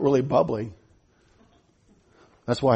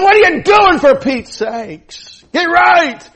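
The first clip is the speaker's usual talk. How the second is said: much higher and louder, hammed-up scolding yell; scream; 12 semitones higher, 6 dB louder, much slower than usual. Nehemiah screams at the temple traders, shouting. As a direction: roar at them to get right. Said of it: "he screamed at them".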